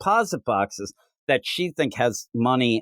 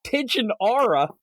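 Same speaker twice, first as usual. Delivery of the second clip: mock yell; 9 semitones higher than usual. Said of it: "and he yells".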